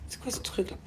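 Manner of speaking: a puzzled thought, whispered under her breath; quick whispered wonder; whispered aside. Real, not acted. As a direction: whisper it to yourself, baffled.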